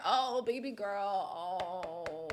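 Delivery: mock cooing. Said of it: "Paige was out there".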